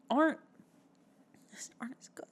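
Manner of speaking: normal talking voice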